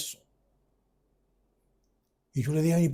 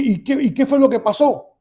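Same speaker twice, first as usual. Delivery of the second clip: high, loud, stumbling question; urgent questioning; 7 semitones higher, 5 dB louder than usual. Retold or imitated. Son asks father what happened to him, urgent. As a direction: demand to know, astonished.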